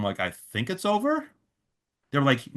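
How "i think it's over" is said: softly voiced, much higher, rising into a question; tentative answer. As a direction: break it gently, unsure it's true. Hesitant answer to worried venue staff.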